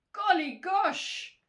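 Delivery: high, loud, slow astonishment; farmer's big surprised cry; startled exclamation. A narrator's reading. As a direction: exclaim it in storybook shock.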